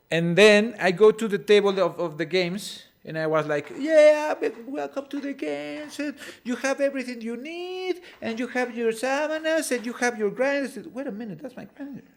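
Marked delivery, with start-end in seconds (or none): 3.8-10.8 high-pitched, sing-out game host; acted-out high host patter; hyped host welcome. Acting out welcoming people to games. 11.0-12.0 high, quick, hushed double take; acted startled recognition; sudden realization. Acting out sudden realization at table.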